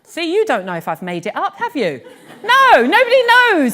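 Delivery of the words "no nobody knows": teasing exclamation. Real, not acted. Teasing the audience playfully.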